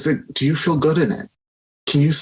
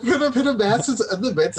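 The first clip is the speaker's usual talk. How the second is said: higher, laughing while repeating the joke; 8 semitones higher than usual. Real, not acted. Echoing the co-host's mask joke while laughing. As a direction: laugh the words out with him.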